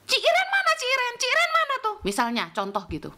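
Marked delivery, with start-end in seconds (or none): 0.1-2.0 very high-pitched calling out by name; imitating a bossy, high-voiced summons; mock yelling. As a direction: shout across the room for a missing helper. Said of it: "Diteriak-teriakin".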